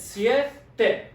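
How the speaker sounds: siete split as "sie", pause, "te"; two separate syllables. Shown as a demonstration. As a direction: say siete slowly in two parts. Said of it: "two syllables".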